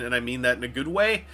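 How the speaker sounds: ordinary delivery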